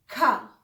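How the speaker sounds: खा with breath; German K. Shown as a demonstration. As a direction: say खा aspirated. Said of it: "के". (खा बोलिए)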